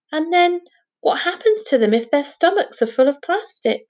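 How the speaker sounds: concerned questioning. Acted. Jazz asks the professor about dolphins, concerned questioning.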